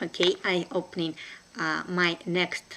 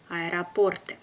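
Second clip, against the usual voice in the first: brisk, natural native speed; quick native pace; 2 semitones lower than usual. Shown as a demonstration. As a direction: say it fast like a native. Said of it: "second time will be quickly, like a native".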